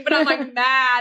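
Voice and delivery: ordinary delivery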